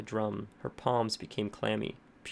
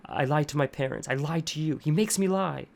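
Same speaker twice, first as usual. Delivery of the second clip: frantic confession; 4 semitones higher, 5 dB louder than usual. A narrator's reading.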